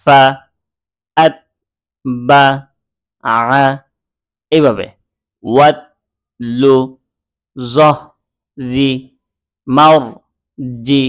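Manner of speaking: each sound read alone with long pauses between; letter by letter. Shown as a demonstration. Teacher shows reading each letter's sound without spelling. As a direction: sound out every letter separately, unhurried. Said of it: "সরাসরি উচ্চারণটা পড়ব".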